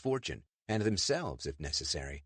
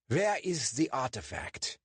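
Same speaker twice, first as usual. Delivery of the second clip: put-on German-accented demand, pitched up; 7 semitones higher than usual. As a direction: press for the artifact like a German officer. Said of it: "the German demanded".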